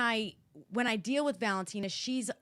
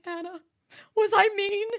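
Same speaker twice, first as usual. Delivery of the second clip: higher, crying question; tearful; 11 semitones higher, 7 dB louder than usual. Retold or imitated. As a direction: ask it through tears. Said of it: "get upset and cry".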